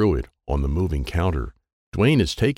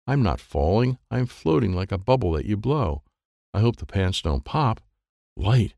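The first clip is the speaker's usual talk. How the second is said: plain reading voice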